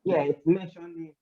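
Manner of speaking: muffled by a hand over the mic; mic covered. Real, not acted. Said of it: "you're covering the mic".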